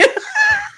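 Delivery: laughing at the Lex-Trump joke; high squealing laugh. Real, not acted.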